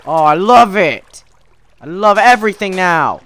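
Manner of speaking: loud mock enthusiasm; hammed-up elation; over-the-top gushing. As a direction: gush loudly about everything.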